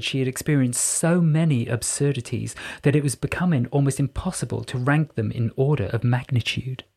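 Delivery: usual speaking voice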